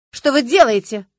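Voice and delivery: indignant. A narrator's reading. Reporter protesting to the detective, indignant. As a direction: demand it, offended. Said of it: "возмутился корреспондент".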